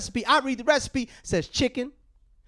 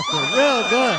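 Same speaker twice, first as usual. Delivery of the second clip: slow, much higher, louder mock boast; sarcastic cheer; 7 dB louder, much slower than usual. Real, not acted. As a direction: sell the tiny crowd as huge. Sarcastic crowd joke.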